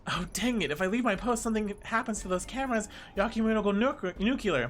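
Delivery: flustered officer voice; fretting officer. Acted. Acts officer fretting over post.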